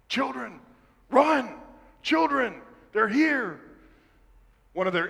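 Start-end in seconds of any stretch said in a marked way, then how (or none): 0.1-3.6 high, urgent mock scream, each call falling away; imitated screaming father. Father warning his children of attackers, retold scream. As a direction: cry a warning out, as a retold alarm. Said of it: "and his father screaming".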